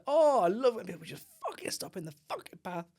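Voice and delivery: mock cheerful, dripping with spite; loud sarcasm. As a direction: say it so strangers overhear. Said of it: "I'll say it loud enough for them to hear".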